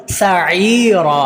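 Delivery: सईरा very slow, ee held long; lengthened ain. Shown as a demonstration. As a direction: draw out the middle of सईरा. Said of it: "आइन को लंबा करेंगे". (सईरा में 'ई' लंबा खींचें)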